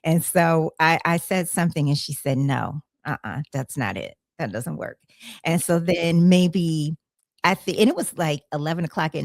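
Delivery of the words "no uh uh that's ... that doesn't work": lower, softer voice of a friend saying no; friend's dismissal. Retold or imitated. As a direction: quote a quiet, low-pitched refusal. Quoting friend's flat rejection.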